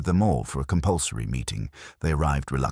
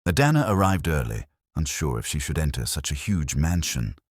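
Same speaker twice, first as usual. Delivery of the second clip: ordinary delivery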